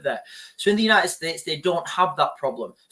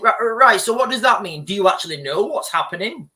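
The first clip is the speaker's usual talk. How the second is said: exasperated retort; 5 semitones higher, 6 dB louder than usual. Retold or imitated. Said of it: "And then you think".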